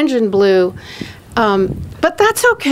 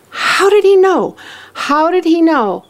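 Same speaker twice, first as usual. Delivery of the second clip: high, emphatic reenacted astonishment; amazed wondering; 5 semitones higher than usual. Devotee thinking after the call, amazed. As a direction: marvel at it in a raised pitch.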